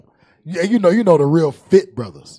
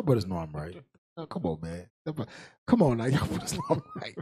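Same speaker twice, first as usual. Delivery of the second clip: laughing; 6 semitones lower, 10 dB quieter than usual. Real, not acted. Laughing appeal to the men.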